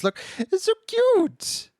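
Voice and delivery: very high, slow squeal; gushing cute voice. Real, not acted. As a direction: squeal over something adorable. Gushing over emotes.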